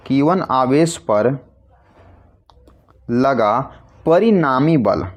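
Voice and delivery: slow, word by word with pauses, for writing down; measured dictation pace, pausing between phrases. Shown as a demonstration. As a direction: dictate it slowly so students can write. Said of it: "यहाँ लिखते हैं आपके लिए".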